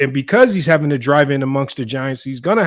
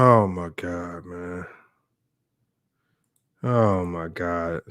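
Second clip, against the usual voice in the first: weary disbelief; 8 semitones lower, 7 dB quieter, much slower than usual. Real, not acted. Disbelief at term foul baiting.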